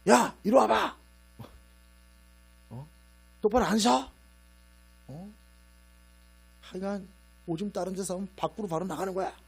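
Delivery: loud, high threatening bark at the dog. Retold or imitated. Man scolding the family dog, loud threatening shout. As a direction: bark orders like a drill sergeant. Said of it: "일단 위협으로 들린대요".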